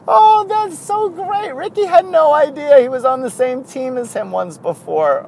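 high, loud, laughing real hard; cracking up. Real, not acted. Host tickled by Rickey having no idea, cracking up. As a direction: squeal it through real laughter.

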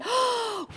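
high startled gasp; playful worried gasp; nervous gasp. Retold or imitated. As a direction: catch your breath in mock alarm. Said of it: "And then I went".

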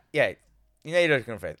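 ordinary talk

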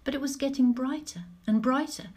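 usual speaking voice

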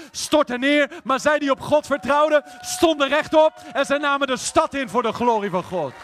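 loud, high preaching build; rousing crescendo to a shouted climax; full-force preaching. Acted.

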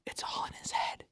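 soft whisper. Real, not acted. Friends whispering while watching, hushed. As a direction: say it under your breath.

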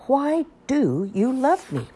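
put-on slow, high pleading child. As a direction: stretch it out like a child.

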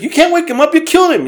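put-on a small kid telling grandpa off; indignant child. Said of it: "And Jesus like".

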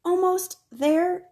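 high, slow, strained as she stretches; reaching on tiptoe, voice pulled up; straining to reach. A narrator's reading. Stretching for a book.

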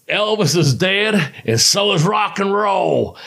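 slow, high mocking taunt at an opponent; retold ring trash talk. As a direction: drag it out to needle him.

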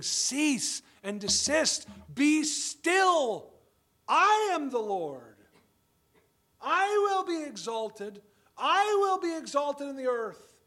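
God proclaiming. Acted. God speaking to rebellious nations, high, loud and slow. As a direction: thunder it as the Lord over the nations. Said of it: "He's saying".

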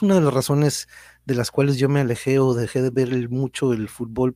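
ordinary delivery

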